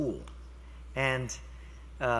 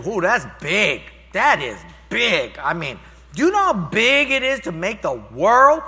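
mock awe; 3 semitones higher, 10 dB louder than usual. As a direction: act hugely impressed for a child. Grandpa feigning awe.

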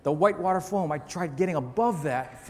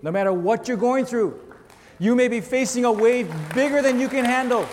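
raised and louder, pounding the point; full-force preaching; 6 semitones higher, 4 dB louder than usual. Acted. Preacher urges the church about facing waves, raised and louder. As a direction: preach it to the back row.

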